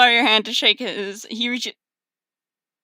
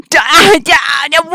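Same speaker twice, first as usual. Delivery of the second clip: loud, high babble breaking into laughter; botched speech collapsing into laughing; garbled stammered noise; 8 semitones higher, 10 dB louder than usual. Real, not acted. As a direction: trip over the words into a silly yelp. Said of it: "what the fuck was that?".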